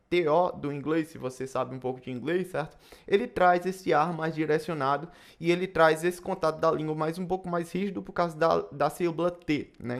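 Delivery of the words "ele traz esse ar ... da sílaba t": usual speaking voice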